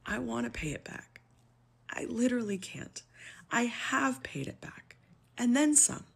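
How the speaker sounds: high, whispered, breaking with tears; fighting back crying on the last words; near tears. Real, not acted. Pleading with critics about her loans, tearful whisper. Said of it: "I am trying not to cry at this point".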